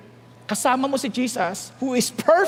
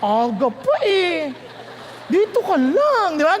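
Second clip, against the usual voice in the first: slow, drawn-out lover's plea; clingy girlfriend; 2 semitones higher, slower than usual. Acted. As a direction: play a woman coaxing her boyfriend to stay. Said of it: "Ano sabi ng babae?".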